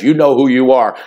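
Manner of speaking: ordinary talk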